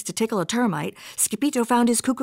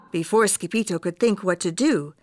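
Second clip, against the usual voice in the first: plain reading voice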